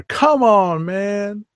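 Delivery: high, hyped come on man; Ed Lover impression. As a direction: belt it like the radio host. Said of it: "I sound like Ed Lover".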